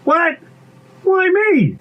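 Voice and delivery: very high incredulous squeal; alarmed protest. Acted. Pea objects to checking the log, alarmed. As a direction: object with pitch shooting up.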